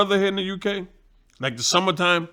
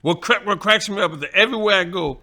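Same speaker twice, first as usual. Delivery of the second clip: ordinary delivery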